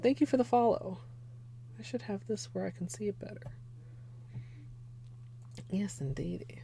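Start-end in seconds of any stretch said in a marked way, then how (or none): 2.0-6.6 soft unvoiced aside; whispering. Real, not acted. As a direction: whisper it to yourself. Aside while adjusting screen.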